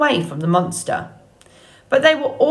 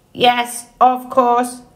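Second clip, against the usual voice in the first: higher, level machine monotone; robot voice; 4 semitones higher, 3 dB louder, slower than usual. A narrator's reading. Robot agreeing to feed the gosling, obliging monotone. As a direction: agree on one raised, even note. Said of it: "returning to her normal voice".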